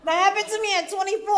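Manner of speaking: high, laughing cry. Real, not acted. Recovery speaker laughing about waking up at twenty-four. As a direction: laugh it out near tears. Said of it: "Oh my God, it was horrible".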